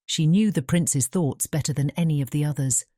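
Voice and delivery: usual speaking voice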